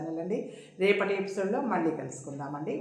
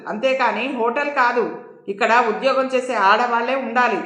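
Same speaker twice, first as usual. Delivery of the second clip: a scolding warden, lightly shouted; understated acted yelling; mild narrated shouting; 4 semitones higher, 9 dB louder than usual. A narrator's reading. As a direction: voice an irate warden telling someone off. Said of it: "అరుస్తూ అంది నీలమణి".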